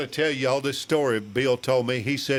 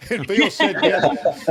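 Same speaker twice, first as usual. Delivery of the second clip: punchline through laughter, higher and louder; laughing; 6 semitones higher, 7 dB louder, slower than usual. Real, not acted. Punchline of funny story.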